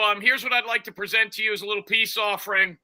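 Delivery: normal talking voice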